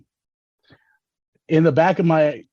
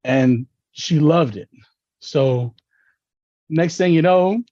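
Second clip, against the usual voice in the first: ordinary talk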